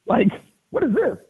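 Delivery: very high disbelieving question; high incredulous "what is this"; baffled. Real, not acted. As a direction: squeak it out in disbelief. Baffled by five runs given up.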